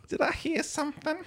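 high, soft, strange creepy voice; Old Greg voice. Acted. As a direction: ask it high and creepy, hushed. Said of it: "You sound like old Greg".

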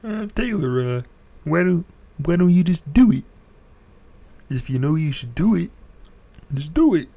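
high, loud nagging voice; imagined listener. Retold or imitated. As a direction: press him like an impatient fan. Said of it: "you're thinking".

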